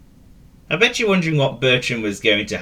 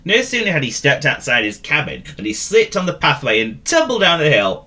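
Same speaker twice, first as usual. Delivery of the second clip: usual speaking voice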